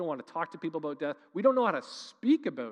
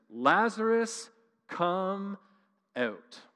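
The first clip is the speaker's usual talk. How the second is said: a slow, drawn-out, louder call to the dead; 4 semitones higher, 4 dB louder, much slower than usual. Retold or imitated. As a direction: call him out of the tomb, slow and strong. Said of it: "In a loud voice".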